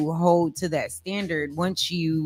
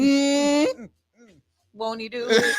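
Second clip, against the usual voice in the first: church amen; 10 semitones higher, 3 dB louder than usual. Pretend. Church call and response.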